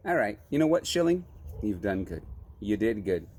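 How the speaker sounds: normal talking voice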